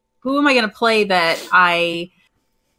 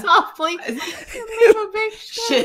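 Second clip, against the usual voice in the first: laughing; 10 semitones higher, slower than usual. Real, not acted. Quoting the joke while laughing.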